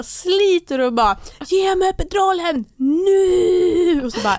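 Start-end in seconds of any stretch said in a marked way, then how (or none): 1.5-4.0 high, slow wailing roar; retold desperate demand. Labouring woman grabbing the midwife's clothes, desperate demand. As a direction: drag out the last word in a desperate howl.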